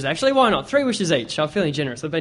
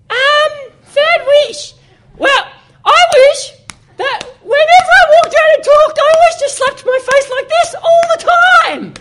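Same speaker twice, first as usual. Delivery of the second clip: squeaky voice; 8 dB louder than usual. Acted. Third guy in squeaky voice.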